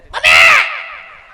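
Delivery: put-on long high shrill cry of a fallen kid; child wailing mommy. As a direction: howl for mom like a hurt little boy. Child falls and cries for mother. (રડતા બાળકની લાંબી ચીસ)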